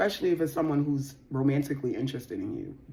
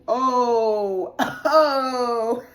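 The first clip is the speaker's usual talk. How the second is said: loud, high burst of laughter; real laughter bursting out; 6 semitones higher, 7 dB louder than usual. Real, not acted. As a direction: crack up out loud.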